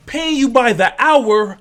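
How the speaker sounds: high, slow, loud disbelieving echo; incredulous. Retold or imitated. Retelling the PC repairman, echoing his price in loud disbelief. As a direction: throw his words back at him, stunned.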